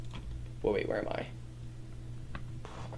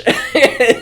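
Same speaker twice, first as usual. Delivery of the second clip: high squeal laugh; 8 dB louder than usual. Real, not acted.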